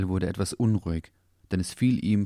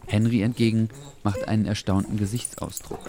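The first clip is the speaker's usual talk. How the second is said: plain reading voice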